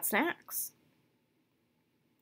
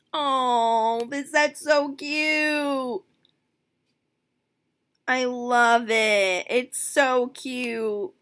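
gushing; 3 semitones higher, 4 dB louder, much slower than usual. Real, not acted. Gushing over carnival set.

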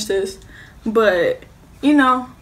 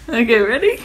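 laughing; 7 semitones higher, 7 dB louder than usual. Real, not acted. Laughing before last bite.

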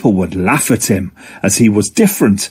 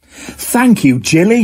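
higher, pleased octopus voice; 10 semitones higher, slower than usual. Acted. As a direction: thank her happily. Octo thanks Jilly.

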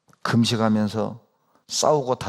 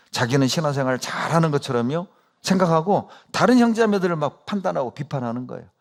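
usual speaking voice

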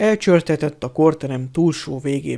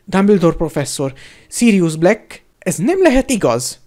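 higher, louder wail of dismay; 4 semitones higher, 5 dB louder than usual. A narrator's reading. Loud dismayed wailing. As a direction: nurse wails at the headmaster. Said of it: "sápítozott Madame Pomfrey".

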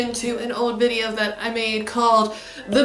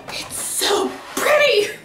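delighted squeal; 14 semitones higher than usual. Real, not acted. Sees the glass unboxed.

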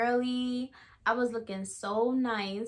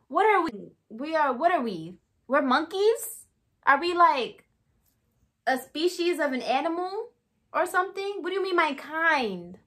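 raised-pitch offended questioning, re-enacted; acted-out disbelief, pitched up; indignant retort; 6 semitones higher, 4 dB louder than usual. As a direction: fire back questions, mildly outraged. Offended retort to "your kind.

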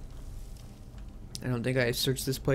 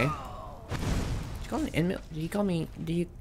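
whispering; 5 semitones higher, 7 dB quieter than usual. Real, not acted. Puzzled whisper.

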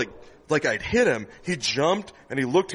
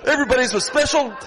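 high, loud, gushing mock joy; hammed-up giddy goodbye; overdone relieved cheer; 11 semitones higher, 6 dB louder than usual. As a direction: hand out love frantically to cover the panic. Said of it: "I was like".

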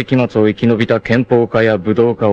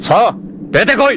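challenging shout; 14 semitones higher than usual. Acted. Challenging hidden enemies. (挑発する叫び)

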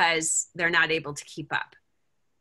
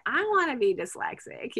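higher-pitched mock whine; playful imitation of a whiny kid; whining child; 7 semitones higher than usual. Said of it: "used to kind of whine".

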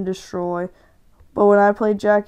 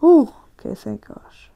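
relieved whisper; 2 semitones higher than usual. Real, not acted. Gamer whispering relief after a close call in the match. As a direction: breathe it out in a whisper.

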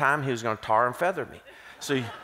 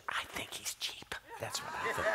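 stage whisper; 9 semitones higher, 15 dB quieter than usual. Acted. Teasing aside about the host.

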